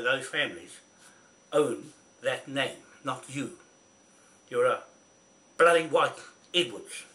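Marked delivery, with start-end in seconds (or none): 4.5-7.0 loud, cutting insult; angry outburst. Real, not acted. Ranting at Kingy on video, loud angry outburst.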